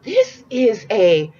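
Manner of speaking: high, slow, voice breaking with joy; overwhelmed by the gift; emotional gasp. Real, not acted. Overcome unboxing.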